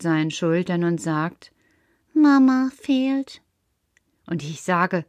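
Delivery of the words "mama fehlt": leise, langsam, traurig. Acted. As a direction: sag es niedergeschlagen und langsam. Trauriger Karl. (Karl gesteht traurig und langsam, dass Mama fehlt)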